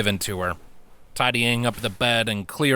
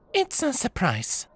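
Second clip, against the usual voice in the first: cryptic smiling; 13 semitones higher than usual. Acted. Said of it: "smiled cryptically".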